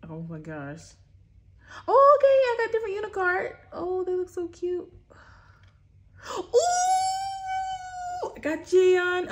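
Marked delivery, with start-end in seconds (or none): 1.9-9.3 very high and loud, bursting with relief; relieved delight. Real, not acted. Vlogger finally pulling a new card, high relieved cheer. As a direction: cheer that it's finally different. Gets different cards at last.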